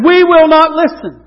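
high, loud cry of refusal; defiant refusal. Acted. Israel rejects God's messenger, loud defiant refusal.

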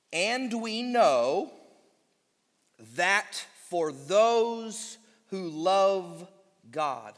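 each word drawn out slow and deliberate; slow weighted reading. Acted. Preacher reading the verse to the congregation, slow and weighty. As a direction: lay the verse down word by word, slowly.

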